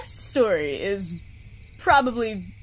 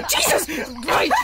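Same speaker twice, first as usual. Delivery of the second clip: startled exclamation; 7 semitones higher, slower than usual. Real, not acted. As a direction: yelp in real shock. Reacts to the jump scare.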